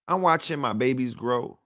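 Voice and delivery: normal talking voice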